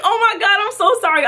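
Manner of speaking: high, gushing apology with a laugh; mortified apology. Retold or imitated. Repeats mortified apology to rapper.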